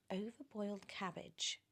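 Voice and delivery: ordinary delivery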